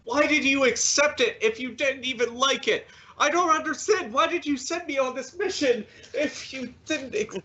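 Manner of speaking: high, bewildered protest; hurt pleading. Acted. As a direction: plead for an answer, voice climbing. Rejected suitor pleads for explanation.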